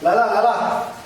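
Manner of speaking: loud, high call to a visitor. Acted. Man answering the door to his auntie, calling out. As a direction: call out that you are coming.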